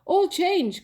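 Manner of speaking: high, loud call. Acted. Mouse calling the others to turn, high loud call. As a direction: shout the switch to the others. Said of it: "called nobody".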